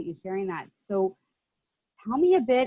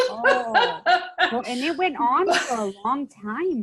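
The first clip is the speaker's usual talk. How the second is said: laughing reaction; 5 semitones higher, 5 dB louder, slower than usual. Real, not acted. Host reacts to guest's story.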